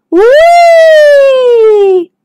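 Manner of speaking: very high, long, loud squeal; gleeful whee. Acted. Little fish bounced off into the sea, gleeful squeal. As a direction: squeal it out as you fly off.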